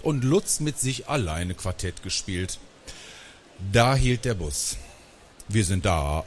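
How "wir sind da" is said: ordinary delivery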